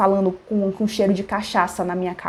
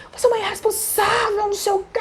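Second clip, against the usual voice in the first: very high mock yell; imitated yelling; 13 semitones higher, faster than usual. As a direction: shriek the accusation in caricature. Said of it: "Por que você tá gritando?".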